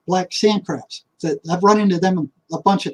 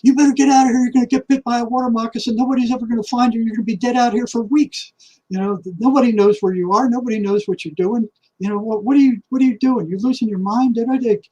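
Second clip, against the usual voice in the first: higher, fast, fretful warning voice; nagging inner voice; 6 semitones higher than usual. Retold or imitated. Inner voice warns him lost in the swamp, high and anxious. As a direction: let the worried inner voice nag and rush, pitched up. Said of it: "here's this voice going".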